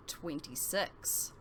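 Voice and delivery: usual speaking voice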